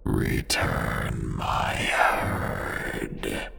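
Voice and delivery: slow whispered deep voice. Acted. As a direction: breathe it out slowly, like something inhuman. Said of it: "I heard a deep voice say".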